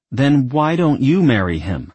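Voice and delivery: sharp comeback; retort. Acted. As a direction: fire it back. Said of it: "Isabel retorted".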